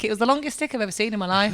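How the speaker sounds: ordinary talk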